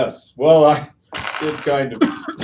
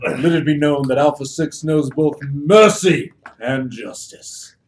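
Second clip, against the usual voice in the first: grand proclamation; 2 semitones higher, slower than usual. Acted. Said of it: "I'm like".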